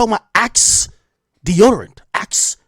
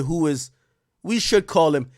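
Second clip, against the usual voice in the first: ordinary talk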